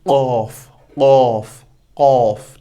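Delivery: कॉफ said heavy, lips rounded; भारी कॉफ; heavy qaf drill. Shown as a demonstration. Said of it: "भारी".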